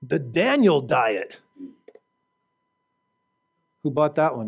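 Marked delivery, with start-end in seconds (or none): 0.0-1.4 slow, dramatic mock announcement of "The Daniel diet"; drawn-out mocking product name; mock title. Mocking prosperity book title.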